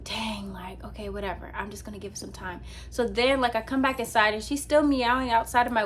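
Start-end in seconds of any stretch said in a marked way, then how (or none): 0.0-2.6 resigned mutter. Retold or imitated. Gives up after cat flees.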